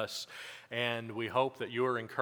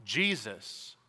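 raised, ringing pulpit voice; preaching; 3 semitones higher, 4 dB louder than usual. Acted. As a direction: preach it out to the congregation with conviction.

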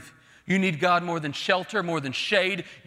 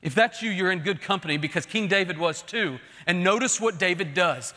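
ordinary talk, nothing marked

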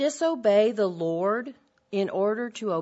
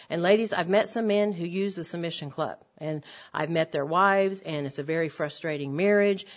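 ordinary delivery

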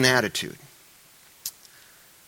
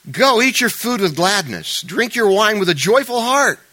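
higher, louder, slow opening; declaimed scripture reading; 8 semitones higher, 5 dB louder than usual. Acted. Pastor reading Ecclesiastes to the church, proclaimed loudly. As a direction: proclaim the verse to the congregation. Reads scripture aloud at raised force.

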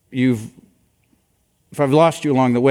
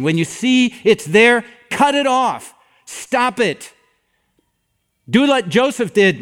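higher, deliberate, hard-hitting sermon delivery; emphatic preaching; 6 semitones higher, 3 dB louder, slower than usual. Acted. Pastor urging folks to cut temptation off, emphatic preaching. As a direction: press the command home, pitched up. In all seriousness.

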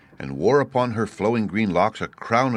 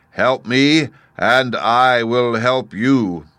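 loud, slow, raised oracle voice; imperious, measured boom; commanding Great Head; 5 semitones higher, 6 dB louder than usual. A narrator's reading. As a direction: lay down terms like a booming giant.

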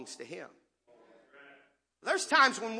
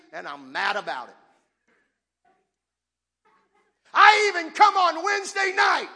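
loud, high indignant rant; older brother shouting his grievance; angry complaint; 9 semitones higher, 12 dB louder than usual. Acted.

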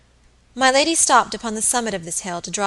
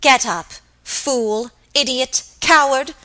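slow separate insults, building louder; hard contempt; 5 semitones higher, 6 dB louder, slower than usual. A narrator's reading. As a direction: hurl each insult coldly at the maid. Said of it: "in a cold, hard voice".

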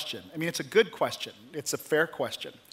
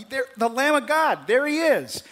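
much higher and a bit louder, insisting; John announcing the Lamb of God, emphatic and raised; adamant proclamation; 8 semitones higher, 4 dB louder than usual. Retold or imitated. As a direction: point him out to the crowd with fervor. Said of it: "John is very adamant".

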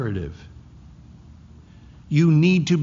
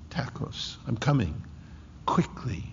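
breathy whisper, softer and lower; hushed whisper; 4 semitones lower, 10 dB quieter than usual. Acted. Preacher voicing Jesus at the door, hushed whisper. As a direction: lean in and breathe the words.

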